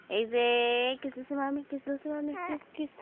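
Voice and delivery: softly coaxing. Real, not acted. Whispering kiss request to baby.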